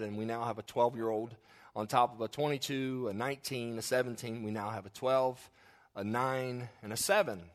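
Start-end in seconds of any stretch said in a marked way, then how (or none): none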